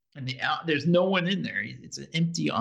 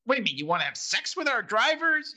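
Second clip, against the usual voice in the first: higher-pitched, mildly incredulous question; mock incredulous; 7 semitones higher than usual. Receptionist questioning a caller's meaning, mock incredulous. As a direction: imitate someone jumping to a startled conclusion. Retold receptionist jumping to conclusions.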